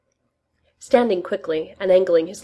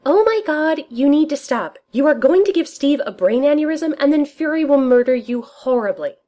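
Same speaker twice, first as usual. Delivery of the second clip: higher, louder exasperated protest; appalled outburst; 9 semitones higher, 6 dB louder than usual. Acted. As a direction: scold him, scandalised. Darcy scolds Tony, appalled.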